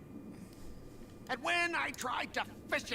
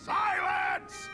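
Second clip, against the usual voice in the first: sharp commanding yell; shouting; 10 semitones higher, 5 dB louder than usual. Acted. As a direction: bellow it to silence the room. Angry student shouts at class.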